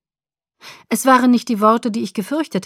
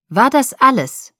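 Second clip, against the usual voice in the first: icy question, pitch raised; 4 semitones higher, 5 dB louder than usual. A narrator's reading. Alice questions Jacob about the call, icy. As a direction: press coldly, louder on the demand. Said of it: "fragte Alice eisig".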